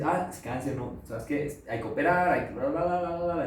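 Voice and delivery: mock hurried doctor. Doctor voiced in story.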